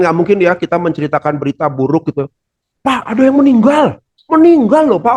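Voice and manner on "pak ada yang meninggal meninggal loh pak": high, loud, cheery announcing of a death; wrong excited tone. Shown as a demonstration. A teacher demos the wrong upbeat way to report a death. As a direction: blurt the sad news brightly, too excited. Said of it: "Orang kan nggak gitu ya".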